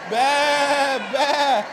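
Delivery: high, loud bleating like a sheep; loud sheep baa. Shown as a demonstration. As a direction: bleat out loud with the whole flock. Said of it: "Loud as we can now".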